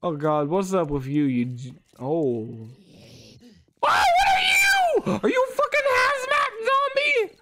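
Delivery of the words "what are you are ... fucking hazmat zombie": high panicked shriek; panicked scream. Real, not acted. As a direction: scream in real terror. Panicked at a monster.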